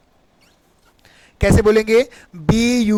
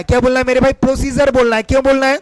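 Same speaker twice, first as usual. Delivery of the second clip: usual speaking voice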